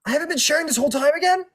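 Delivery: high, fast, exasperated squeal; exasperated outburst. Real, not acted. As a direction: blurt it out in high-pitched dismay. Host exasperated at missed screen share.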